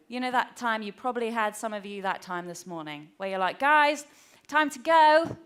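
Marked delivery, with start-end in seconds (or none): 3.6-5.3 raised, higher calling-up voice; first call to the kids, measured. Retold or imitated. Mum calling the children to leave, controlled calling out. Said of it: "we needed to go".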